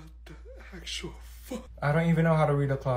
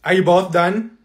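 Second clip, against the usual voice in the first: stern teacher; 8 dB louder, slower than usual. Acted. Teacher scolding chatty students.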